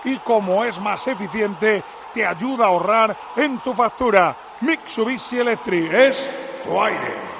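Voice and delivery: live sponsor plug; ad read. Acted. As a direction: read the sponsor line smoothly.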